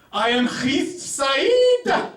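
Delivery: high, drawn-out comic Arabic voice; Arabic twin impression. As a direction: play one hip-hop-loving Arabic twin, loud and stretched. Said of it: "two Arabic conjoined twins".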